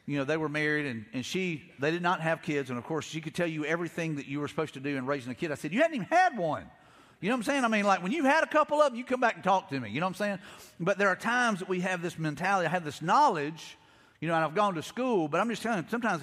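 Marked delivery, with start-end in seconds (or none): 5.7-9.9 higher and louder, you can't be serious; pointed disbelief, pitched up; incredulous retort. Retold or imitated. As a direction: push back hard on a childless advice-giver. Mocking a childless know-it-all.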